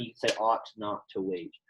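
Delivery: usual speaking voice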